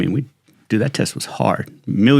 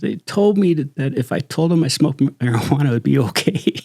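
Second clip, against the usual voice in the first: higher-pitched imitation of crying; mock crying; 6 semitones higher than usual. Rejected applicant on the hallway phone, mock crying. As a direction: retell it as a tearful complaint, lightly hammed up. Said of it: "she's crying, dude, just crying".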